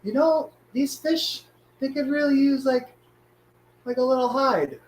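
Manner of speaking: high, sing-song inner voice; high silly voice. Retold or imitated. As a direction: pitch it up like a cartoon. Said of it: "I'm like".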